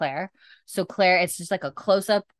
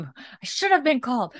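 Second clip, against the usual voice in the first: higher mock-indignant snap; 6 semitones higher than usual. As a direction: quote her outburst with relish. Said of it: "she fucking slams the".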